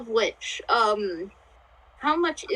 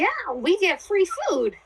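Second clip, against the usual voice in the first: high, drawn-out gleeful cheer; delighted; 9 semitones higher than usual. Acted. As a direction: celebrate it slowly, pitch swooping up. Delighted at free food prize.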